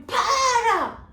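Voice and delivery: very high, loud desperate yell of Para; shouted stop. Acted. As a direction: scream Para to stop him. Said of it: "chegou perto da professora e falou pro homem".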